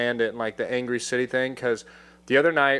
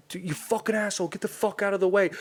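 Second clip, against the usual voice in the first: retold yelling; 7 semitones higher, 4 dB quieter, faster than usual. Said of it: "yelling at the guy".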